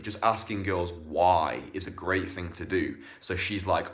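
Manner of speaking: normal talking voice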